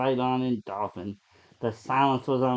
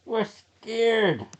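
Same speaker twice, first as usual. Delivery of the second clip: scared; 9 semitones higher than usual. Acted. Lisa begs the man to let them in.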